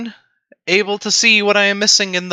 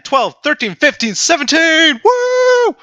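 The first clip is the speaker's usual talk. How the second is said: real joy as the code finally works; excited counting; 11 semitones higher, 4 dB louder than usual. Real, not acted. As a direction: pitch climbing to a loud, high cheer. Code finally runs, counting tree output.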